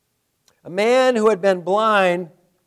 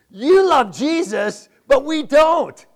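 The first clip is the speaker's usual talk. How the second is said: slow, louder, higher mocking paraphrase; 6 semitones higher, 6 dB louder, slower than usual. Pastor mocking the Pharisees' insult, slow and loud. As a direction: drag it out like a taunt. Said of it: "Basically, they're saying".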